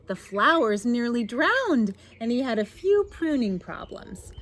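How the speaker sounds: put-on lively read-aloud to children; story narration. A narrator's reading. Reads picture book aloud.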